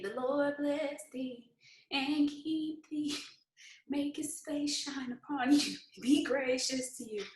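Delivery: slow, soft benediction tone; mock blessing. To a downline member moved to another box, soft mock blessing. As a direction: bless them off gently, like a pastor. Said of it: "and say".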